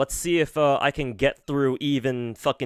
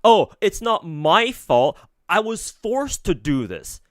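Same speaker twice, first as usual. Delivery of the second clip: slow, much higher mock victim whine; whiny victim; 6 semitones higher, 4 dB louder, slower than usual. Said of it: "love playing fucking victim".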